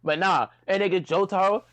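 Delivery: usual speaking voice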